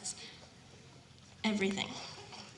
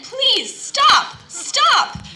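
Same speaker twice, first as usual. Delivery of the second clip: high, loud frantic plea; begging; 13 semitones higher, 12 dB louder, much slower than usual. Acted. Teacup spinning on the wheel, high loud begging. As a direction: beg to be let off the wheel. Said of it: "I begged again".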